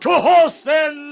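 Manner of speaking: high, loud rallying shout; rallying call. Acted. King sending the host to ride, rallying shout. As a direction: rouse the riders.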